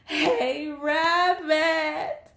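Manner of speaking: high, drawn-out shout to Rabbit. A narrator's reading. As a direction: shout it out like a desperate hungry bird. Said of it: "shouted Bird".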